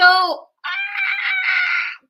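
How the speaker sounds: high, loud cry of irritation; exasperated scream. Acted. Fed up with the accents, high exasperated scream. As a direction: shriek as if at nails on a chalkboard.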